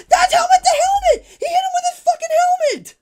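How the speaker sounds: laugh-shouting in real outrage; furious shout. Real, not acted. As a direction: peak of a fan's angry rant. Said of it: "I'm not trying to get worked up".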